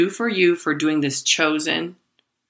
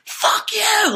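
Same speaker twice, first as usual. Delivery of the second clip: much higher, sudden outburst; shouting at herself. Retold or imitated. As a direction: snap back at your own calm voice with a yell. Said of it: "Like, I'd shout to myself".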